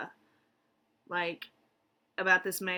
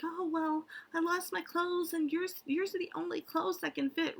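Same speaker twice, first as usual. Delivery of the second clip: very high girly cartoon voice; high-pitched anime girl; 10 semitones higher than usual. [name] tells the princess she lost her clothes, high-pitched girly voice. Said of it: "that really high-pitched voice like that Princess".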